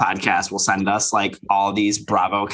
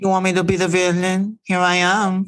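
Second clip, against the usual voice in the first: higher put-on voice; mock villain entrance; 8 semitones higher than usual. Voicing the new villain to co-host, higher put-on voice. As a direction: announce it in a raised, put-on voice.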